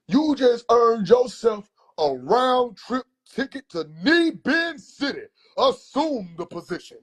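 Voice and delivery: hammed-up uncle sentencing, drawn out; grand, slow punishment decree; theatrical uncle. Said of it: "he gotta be theatrical".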